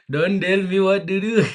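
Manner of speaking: put-on a joking Indian-accent outburst, playfully loud. Streamer jokes to chat in a loud Indian accent. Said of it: "let's start with the Indian accent".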